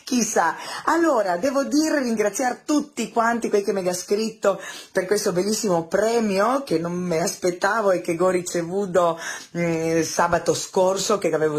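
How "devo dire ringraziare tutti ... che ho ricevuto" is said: normal talking voice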